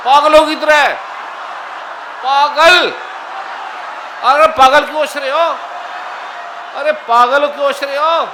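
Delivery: high, loud calls over a crowd; shouted urging. Real, not acted. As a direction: goad everyone into laughing harder. Urging crowd to laugh like mad.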